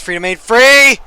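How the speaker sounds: loud burst of commentary; excited shout. Acted. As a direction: yell it like a goal chance. Shouting a near-goal chance.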